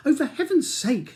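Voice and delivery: wife interrupting husband, exasperated; exasperated interruption. Acted. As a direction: cut him off impatiently. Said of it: "she interrupts".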